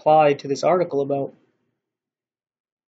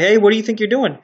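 loud, higher-pitched telling-off; parental scolding; 5 semitones higher, 6 dB louder, faster than usual. Acted. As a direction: scold a child caught at it. Parent scolding child at outlet.

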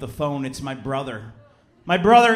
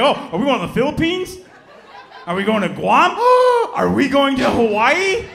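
rising giddy questions building to a gasp and shout; getting more thrilled with each island, then shouting Hawaii; mock excited guessing; 5 semitones higher, 6 dB louder than usual.

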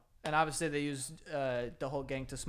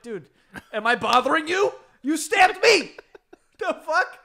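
high, loud laughing indignation; stabbed man outraged at being shushed; mock outrage; 12 semitones higher, 12 dB louder than usual. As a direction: act the offended victim. Outraged stab victim.

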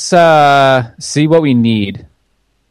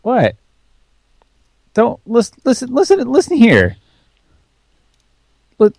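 mock exasperated; 11 semitones higher than usual. Mock quarrel over fetching wood.

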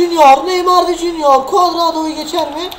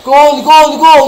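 goal shout; 6 dB louder, much slower than usual. Real, not acted. Celebrates a goal.

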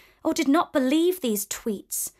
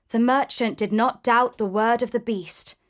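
ordinary delivery, nothing marked

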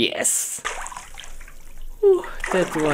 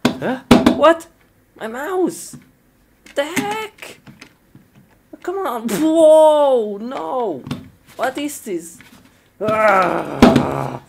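confused shock; 11 semitones higher, 6 dB louder than usual. Real, not acted. Mouse suddenly acting strangely.

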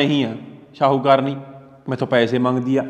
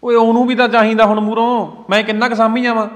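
louder, fed-up complaint; exasperated; 3 semitones higher, 6 dB louder than usual. Acted. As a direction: vent his frustration. Son complains to mother angrily.

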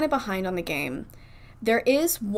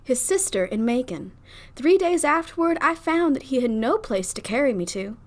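lightly Southern belle reading; a hint of a Southern debutante; Southern debutante accent; 4 semitones higher than usual. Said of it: "in a Southern debutante accent".

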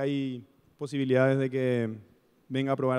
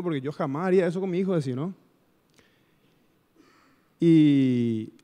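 preaching; 5 dB louder than usual. Acted. Preacher turning his boyhood story to the congregation, louder and fervent. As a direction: lift the room toward the sermon's point.

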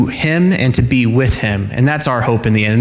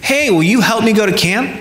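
put-on eager child; 9 semitones higher than usual. Daughter asking shoppers.

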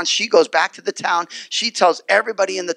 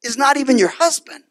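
raised reveal; 8 semitones higher than usual. Retold or imitated. Jesus exposing the woman's secret, raised voice. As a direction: call her out with a raised voice. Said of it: "He says".

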